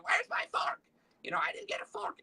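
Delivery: very high, squeaky mock customer whine; whiny complainer. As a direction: mimic a petulant diner in a squealing voice. Imitates complaining restaurant customers.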